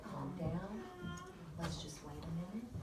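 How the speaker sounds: soft, hushed calming of a patient; a soft soothing midwife; calm reassurance. Retold or imitated. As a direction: quietly settle her panic. Calming panicking mother.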